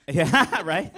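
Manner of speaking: big laughing yeah; loud, laughing agreement. Real, not acted. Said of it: "I just have a naturally loud voice".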